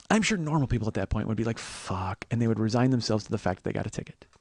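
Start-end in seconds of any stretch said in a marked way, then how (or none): 1.6-2.3 soft, low, defeated; acted-out muted resignation; resigned curse. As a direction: let it drop quietly in resignation. Normal people resign themselves.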